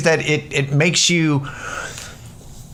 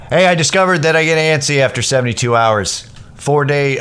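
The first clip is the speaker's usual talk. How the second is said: normal talking voice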